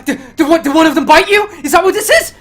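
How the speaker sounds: high, loud, stammering alarm; frantic gunman interrogating; panicked questioning. Acted. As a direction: demand answers in rising panic.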